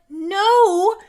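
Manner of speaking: loud, high exasperated outburst; fed-up, high-pitched objection; exasperated protest. Retold or imitated. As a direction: push back loudly, dragging out the key words. Exasperated retort to the mocked opinion.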